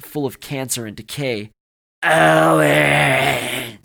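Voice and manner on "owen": very loud, long, higher growled roar; monster growl. A narrator's reading. Rat beast closing in on Owen, growling. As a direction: bellow the name like a dying beast. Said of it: "it growled again".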